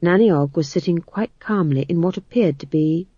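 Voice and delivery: ordinary delivery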